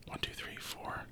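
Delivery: counting under the breath, barely voiced; whispered counting. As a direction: whisper the count so nobody hears. Groom silently counting at the altar.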